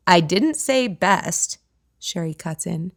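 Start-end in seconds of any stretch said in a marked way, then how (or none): 0.1-1.6 slow, deliberate correction; firm correction. A narrator's reading. Sherry correcting Kate, slow and firm. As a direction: set her straight slowly.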